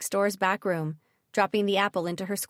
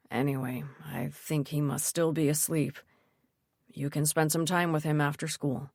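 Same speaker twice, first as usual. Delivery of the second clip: father voice; 4 semitones lower than usual. Acted. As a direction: voice the calm father, low. Narrator voices Dad.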